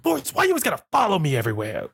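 quick put-on scolding; mock exasperated sweetheart. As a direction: play the pestered girl, hammed up. Acted reply in Forrest Gump scene.

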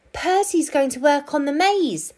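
high, excited fox voice; plotting fox. A narrator's reading. Fox telling hiding friends the news, excited.

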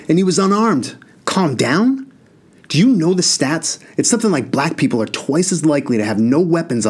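high, heated rant; railing at his parents, voice up; outburst. Acted. Enraged brother at hospital bed.

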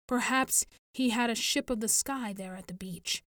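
ordinary delivery

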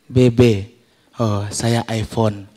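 low, slow show-off reply; boastful seatmate. Retold or imitated. Seatmate showing off his iPhone, low smug voice. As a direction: lightly act a passenger bragging, pitched low. Seatmates compare phones, one shows off.

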